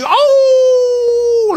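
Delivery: long, very high loud howl; imitated howl. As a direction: howl out one sustained mock wail. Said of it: "aullidos, dice". (aullido largo y agudo imitado)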